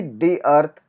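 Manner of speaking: slow "the" said as "dee", then earth; teacher slowly sounds out "the" as "dee" before earth. Shown as a demonstration. Teacher shows "the" said as "dee" before a vowel. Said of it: "तब उसके आगे हम दी बोलते हैं".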